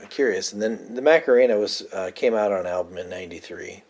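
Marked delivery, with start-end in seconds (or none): none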